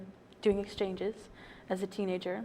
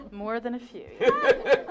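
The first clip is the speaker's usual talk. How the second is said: laughing agreement; 3 semitones higher, 7 dB louder, slower than usual. Real, not acted. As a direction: laugh along at the joke. Guest laughs at host's joke.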